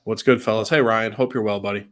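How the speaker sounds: normal talking voice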